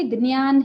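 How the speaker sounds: ज्ञान as "d-ni-yaan"; wrong dnyan form. Shown as a demonstration. Teacher lists errors to avoid, ज्ञान as "dniyaan". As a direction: open ज्ञान on a clear d, "dniyaan". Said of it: "ना ही ज्ञान है".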